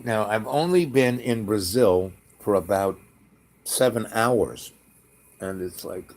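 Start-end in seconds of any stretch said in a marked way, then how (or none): none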